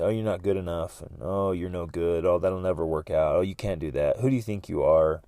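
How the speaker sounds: low, discouraging self-talk; negative inner voice. Acted. Inner critic tearing someone down, low and belittling. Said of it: "They tear us down".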